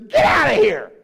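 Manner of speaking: sudden shout, high and loud; commanding shout. Retold or imitated. Preacher voicing Jesus ordering mourners out, loud shout. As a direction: bellow them out of the room.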